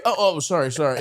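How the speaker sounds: usual speaking voice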